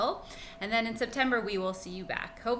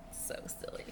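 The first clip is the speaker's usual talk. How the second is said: soft whisper; whispered aside; 12 dB quieter than usual. Real, not acted. Teacher whispering to the class about the silly rhymes. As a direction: breathe it to yourself, amused.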